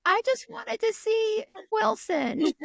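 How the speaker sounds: high, slow mock lament; bewildered audience member. Poor lady who came for the host, high mock lament. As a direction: plead slowly in a high put-on voice.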